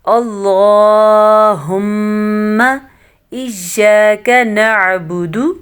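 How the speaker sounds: drawn-out chanted dua; slow recitation. Shown as a demonstration. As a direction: recite slowly and steadily. Leads the recitation.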